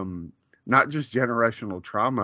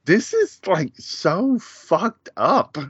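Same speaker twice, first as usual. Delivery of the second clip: shocked disbelief; 9 semitones higher, much slower than usual. Retold or imitated. Rewatching the movie, voicing his own shock. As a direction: stretch out so fucked up, appalled. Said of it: "I'm like".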